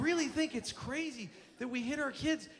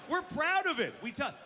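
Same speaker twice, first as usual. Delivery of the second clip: ordinary talk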